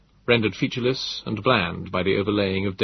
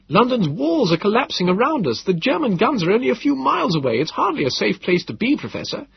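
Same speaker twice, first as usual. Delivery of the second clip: raised, higher voice pressing the danger; urgent warning; 11 semitones higher than usual. A narrator's reading. Pleading with Gödel to flee the shelling, raised and urgent.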